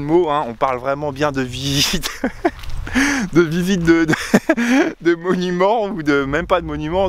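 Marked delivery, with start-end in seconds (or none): 2.2-3.3 giggle. Real, not acted. Laughs at own pun. 4.2-5.0 giggle. Real, not acted. Laughs at own pun.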